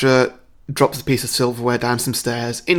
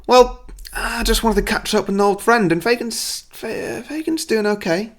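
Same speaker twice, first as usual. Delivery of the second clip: high, halting, acted upset; faltering cover-up; 9 semitones higher than usual. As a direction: trail into a falter on the name.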